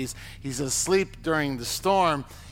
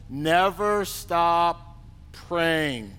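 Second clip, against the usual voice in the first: "Never stop praying" drawn out very slowly, each word weighted; slow emphatic reading; much slower than usual. Shown as a demonstration. Preacher dwelling on the verse, very slow.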